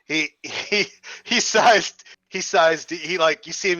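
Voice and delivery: ordinary talk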